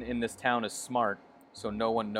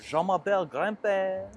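mock French grandpa; 7 semitones higher than usual. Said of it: "work up this grandpa character".

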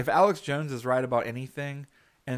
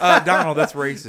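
laughing loud scold; 3 semitones higher, 12 dB louder than usual. Pretend. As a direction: call it out loud through a laugh. Adviser scolds Trump, loud.

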